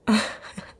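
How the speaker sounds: short breathy laugh; brief, airy chuckle at their worry; amused chuckle. Acted. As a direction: let out a quick amused laugh. Boss amused by listener's worry.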